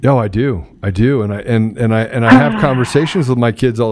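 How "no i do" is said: ordinary talk, nothing marked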